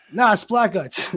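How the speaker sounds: normal talking voice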